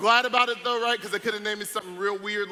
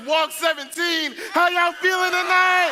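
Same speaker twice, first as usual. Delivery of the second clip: louder, higher, slower crowd call; bigger hype shout; 9 semitones higher, 6 dB louder, slower than usual. Real, not acted. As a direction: pump them up even harder. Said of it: "Let me ask again".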